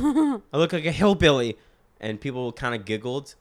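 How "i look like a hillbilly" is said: put-on old bit. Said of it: "my original bit was".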